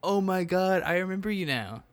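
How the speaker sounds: high, loud exclamation of recognition; surprised recognition. Acted. Latvia recognising an old classmate, high surprised recognition. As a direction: exclaim it as it dawns on you.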